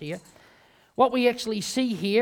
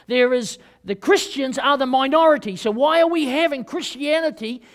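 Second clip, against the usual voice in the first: loud, driving sermon climax; fiery preaching; 5 semitones higher, 9 dB louder than usual. Acted. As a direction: preach it hard to the congregation. Preaching at full force.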